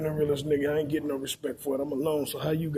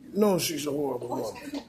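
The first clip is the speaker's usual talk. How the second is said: ordinary delivery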